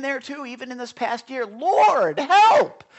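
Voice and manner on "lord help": high, loud cry for help. Retold or imitated. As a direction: call out to God from the bottom of a hard year. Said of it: "There's times he's shouting out".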